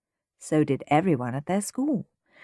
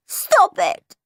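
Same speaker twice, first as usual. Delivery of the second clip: put-on high, raised child's plea; protesting little boy; 13 semitones higher, 5 dB louder than usual. A narrator's reading. Crying Peter to his jeering brother, protesting. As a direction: tell him off in a high little voice.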